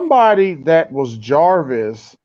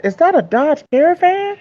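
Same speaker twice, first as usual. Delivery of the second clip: surprised disbelief; 11 semitones higher than usual. Retold or imitated. Retold disbelief at the truck.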